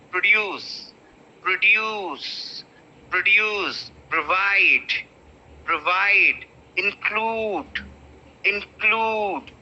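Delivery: produce, provide, include with the weight on the last syllable; last syllable stressed. Shown as a demonstration. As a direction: drill the words, punch the ending each time. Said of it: "I'm stressing the last syllable".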